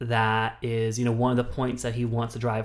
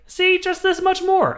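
much higher, louder, hammed-up showman voice; mock Moore; 7 dB louder than usual. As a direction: sell the chart like a smug huckster. Mocking Michael Moore's chart.

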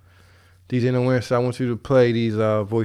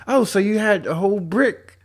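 mocking interviewer voice; 11 semitones higher, 7 dB louder than usual. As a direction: ham up a nosy interviewer. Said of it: "He'll be like".

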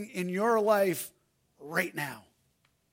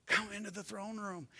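soft after the shout; 16 dB quieter than usual. Acted. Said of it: "he says".

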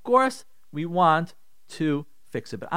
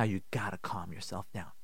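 much lower and much softer, soothing; calm quiet voice; 8 semitones lower, 12 dB quieter than usual. Shown as a demonstration. Rabbi showing how to calm an angry spouse, very soft. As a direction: talk them down gently, barely above a breath. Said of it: "do you hear how I'm saying it".

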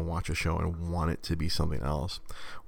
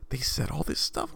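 surprised; 9 semitones higher than usual. Retold or imitated. Young Twitch viewers' shocked reaction.

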